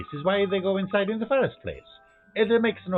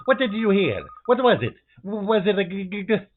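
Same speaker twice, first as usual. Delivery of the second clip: scared, higher, stammering; frightened stammer; 6 semitones higher, 4 dB louder than usual. Acted. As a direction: stutter out in fear. Frightened in haunted house.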